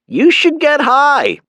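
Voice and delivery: high, slow mother's voice; Mom voice. A narrator's reading. Mother urging her son on the phone, high and slow.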